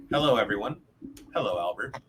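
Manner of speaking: ordinary delivery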